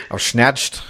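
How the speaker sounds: hint of a Dutch accent on "snatched". Real, not acted. Co-host trying the football phrase, lightly Dutch-sounding accent. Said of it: "is that no a Dutch accent".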